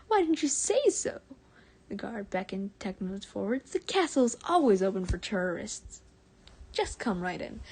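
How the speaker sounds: an eager American guard waving him in. Acted. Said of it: "so I'm doing American".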